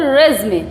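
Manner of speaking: रेज़मे said as "REZ-may"; teacher repeats रेज़मे without a yoo; falling may at the end of रेज़मे; word demo. Shown as a demonstration. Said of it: "क्या बोलते हैं".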